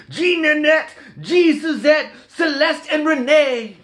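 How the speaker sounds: high, loud Cajun voice calling out the gators' names; Cajun story reading, calling names. Acted. Santa calling his gators by name, high, loud Cajun holler. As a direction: holler each name across the bayou, Cajun style. Reads Cajun Christmas book aloud.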